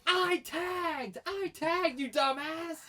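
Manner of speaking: high, indignant mock yell; imitated angry yelling. Wrestler snapping at heckling fan, mock indignant yell. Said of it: "he's just yelling at me".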